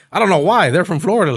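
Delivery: loud, disbelieving outburst; high, loud, amused disbelief; incredulous. Real, not acted. Laughing at Florida fans joining.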